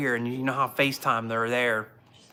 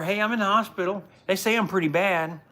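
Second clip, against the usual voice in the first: retold phone call; 6 semitones higher than usual. Retelling phone call to wife.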